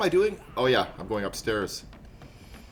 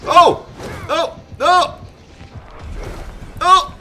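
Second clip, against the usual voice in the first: real high, loud cries of alarm; short, high startled shouts; startled yelps; 6 dB louder, much slower than usual. Real, not acted. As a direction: yelp each word in sudden panic. Startled while playing the game.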